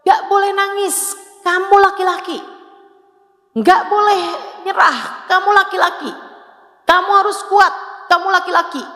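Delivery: high, scolding parent voice; stern parent. Retold or imitated. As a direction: mimic a parent telling a boy not to cry. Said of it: "Selalu aja orang tuanya bilang". (menirukan orang tua yang menegur anak laki-laki)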